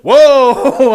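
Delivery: high, loud startled "whoa"; mock shocked coworker. Coworker hearing the swear word, high loud startled gasp. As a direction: recoil in surprise, pitched high. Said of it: "She just".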